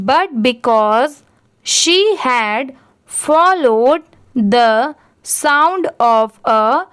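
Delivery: very slow reading with long pauses between phrases. Shown as a demonstration. Teacher reads the paragraph aloud for learners, very slowly. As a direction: read it phrase by phrase for beginners. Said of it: "इस paragraph को भी एक बार read करके देखते हैं".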